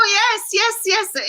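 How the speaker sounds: eager yes. Real, not acted. Eager agreement to visit.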